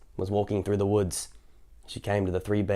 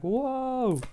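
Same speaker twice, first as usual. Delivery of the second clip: whoa; 14 semitones higher than usual. Retold or imitated. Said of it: "They would have been like".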